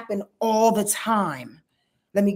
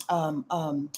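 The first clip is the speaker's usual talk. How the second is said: ordinary talk, nothing marked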